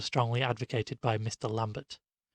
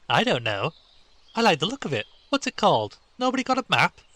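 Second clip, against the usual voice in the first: put-on louder boy, climbing higher to a demand; impatient schoolboy; 7 semitones higher, 7 dB louder, faster than usual. A narrator's reading. Stanley demanding a map from his friends, impatient. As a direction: push the others for a map.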